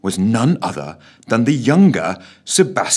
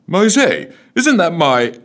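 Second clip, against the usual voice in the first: high, loud alarmed cry cut off; startled old gent, louder and higher; alarmed Scrooge cut off mid-question; startled protest; 9 semitones higher, 5 dB louder than usual. A narrator's reading.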